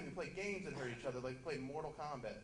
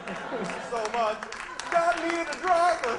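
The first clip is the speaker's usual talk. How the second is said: hammed-up wailing, higher and much louder; mock grief; 11 semitones higher, 14 dB louder than usual. Drunk Southerner mourning his buddy Dale, wailing in mock grief. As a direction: overdo the tearful wail for a lost friend. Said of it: "an uneducated Southerner".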